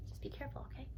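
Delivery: soft whispered aside. Real, not acted. Aside to child during reading.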